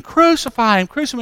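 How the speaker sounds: high-pitched mock screams at moderate volume; restrained, high crowd cry; imitated screaming. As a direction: mimic screams heard from a distant crowd. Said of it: "you can hear the screaming".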